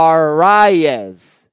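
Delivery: "Arise" drawn out with an extra syllable, a-RYE-ez; slow, loud "Arise" with the second vowel sounded; host's own pronunciation. Shown as a demonstration. Host contrasting two versions of a name, careful pronunciation. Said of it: "I've been saying".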